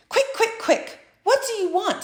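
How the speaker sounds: high hurried snap; impatient Fox. A narrator's reading. Busy Fox hurrying Frog along, impatient. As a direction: rush the caller along.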